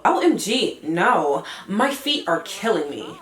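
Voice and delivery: a touch of a whiny diva; Gina. Acted. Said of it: "let's do the voices".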